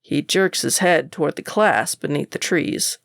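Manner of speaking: ordinary delivery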